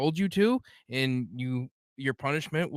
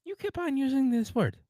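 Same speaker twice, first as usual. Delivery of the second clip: movie quote; 10 semitones higher than usual. Retold or imitated. Quoting Princess Bride line.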